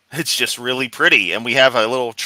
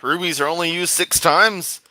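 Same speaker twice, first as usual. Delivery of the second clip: ordinary talk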